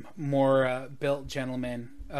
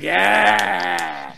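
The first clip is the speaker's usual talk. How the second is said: loud excited pirate cheer; rowdy cheer; 3 semitones higher, 11 dB louder than usual. Acted. Pirate crew cheers the maid's plan loudly. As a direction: whoop it up like drunk pirates.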